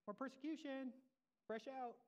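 high-pitched playful plea; mock waiter offer. Preacher jokes about ordering at a restaurant, mock waiter offer. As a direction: pretend table-service voice, lifted high.

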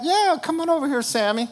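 high, sing-song coaxing call; calling the cat. Acted. Owner calling the cat Sammy to come, high coaxing call. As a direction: coax the cat over sweetly.

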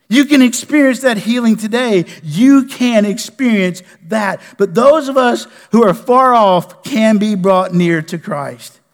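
loud, driving preacher's cadence, pressing every line; preaching at full force. Acted. Pastor urging his congregation toward salvation, preaching at full force.

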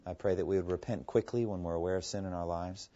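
hushed, earnest prayer; closing prayer. Real, not acted. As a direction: pray humbly to close the study.

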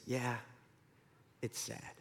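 very soft, low gloom; barely voiced, halting sympathy; Sadness. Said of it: "But Sadness says".

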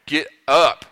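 loud wake-up yell; shouting at a sleeping kid. Retold or imitated. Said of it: "I have to yell".

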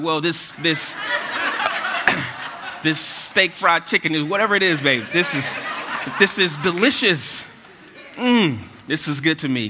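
laughing, overdone food praise; savoring delicious food. Retold or imitated. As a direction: gush over the food through laughter.

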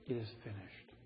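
very soft, fading last words; barely audible final words; dying whisper. Acted. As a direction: breathe it out like a dying man.

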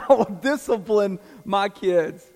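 laughing through the words; chuckling as he corrects himself. Real, not acted. Preacher laughing at his own slip to the congregation.

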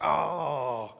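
loud, drawn-out drone; droning "oh". Shown as a demonstration. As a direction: drone a long "oh" as a demonstration. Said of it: "in that droning voice".

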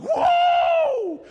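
very high, long whooped shout; exultant whoa. Acted. Preacher imitating Adam overjoyed at Eve, exultant whoa. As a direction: let out a soaring mock howl. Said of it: "He's like".

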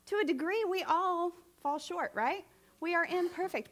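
normal talking voice

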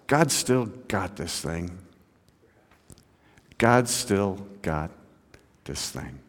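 slow, soft, sinking to near silence; hushed assurance. Real, not acted. Preacher assuring friends God is in control, hushed. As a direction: say it softly, with long pauses.

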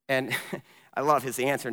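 ordinary talk

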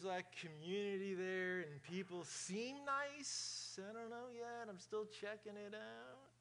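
soft, slow mock churchgoer voice, only lightly voiced; a lightly drawn-out soft voice, gently mocking the casual churchgoer; put-on churchgoer voice. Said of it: "I don't know what accent or what voice this is".

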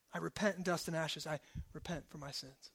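soft, low confession; hushed repentance. Acted. David confessing his sins to God, softly penitent. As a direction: sink into a penitent hush.

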